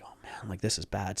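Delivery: whispered "oh man" to yourself; acting out a private sinking thought, nearly voiceless; under the breath; retold hushed dread. Retold panicked thought to himself.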